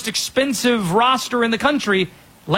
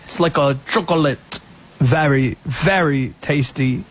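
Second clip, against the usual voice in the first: put-on French accent; 4 semitones lower than usual. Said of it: "It's pretty bad".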